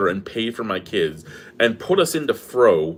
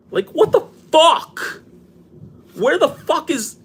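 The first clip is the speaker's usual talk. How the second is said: much higher and slower, real frustration; exasperated yell; 4 dB louder, slower than usual. Real, not acted. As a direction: drag out the curse in fed-up disbelief. Venting frustration on camera.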